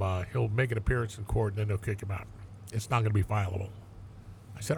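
usual speaking voice

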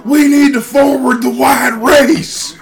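high, slow, booming podium shout; rally shout. Retold or imitated. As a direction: bellow it like a hateful speaker at the mic. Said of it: "he was like".

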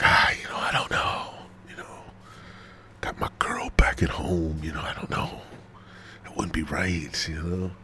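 whispered, unsure refusal; hushed hesitant excuse. Retold or imitated. Telling the woman he has a girl back home, whispered and hesitant. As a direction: mumble a reluctant no under your breath.

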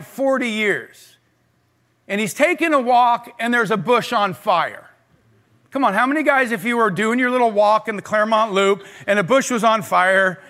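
ordinary delivery

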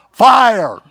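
high, loud mock shout; yelled punchline. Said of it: "she yells out".

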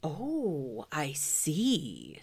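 hushed whispered bear; awed whisper. Acted. Pooh impressed by Owl, hushed whisper. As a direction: breathe it out in wonder. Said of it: "said Pooh".

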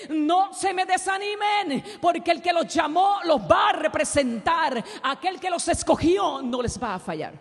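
loud, high, slow preaching cry; rally a persecuted church; Peter's exhortation. Acted. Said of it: "Pedro tenía que decirle". (exhortación predicada a gritos, lenta)

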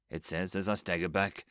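plain reading voice

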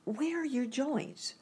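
put-on high-pitched anxious girl's question; a girl anxious to help. A narrator's reading. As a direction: ask it with mild worry, voice lifted. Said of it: "asked anxiously".

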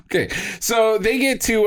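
ordinary delivery

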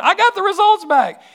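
high, loud joyful cries; high, loud mock rejoicing of the relieved friend; overjoyed praise. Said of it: "I got a call from her".

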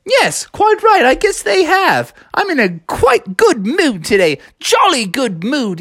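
put-on high, jolly English accent; mock English accent. Froggy answering passersby, jolly mock English accent. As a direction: ham up a cheery English gent.

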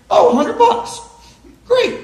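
high, startled "Oh, a hundred bucks"; surprised delight. Real, not acted. Pastor to the woman who brought the money, pitched high in surprise. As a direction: act thrilled at being handed cash.

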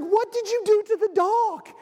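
a mom finding the flour-covered dog, shocked; high-pitched what-did-you-do outburst. Retold or imitated. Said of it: "she's like".

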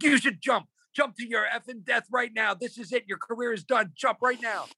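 very high, strained rant; retold yelling. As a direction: act out a boss screaming at a newcomer. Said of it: "He's yelling at me so much".

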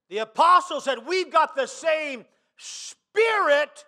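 loud, high, ringing sermon climax; full-force preaching. Real, not acted. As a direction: drive the scripture home to the whole congregation.

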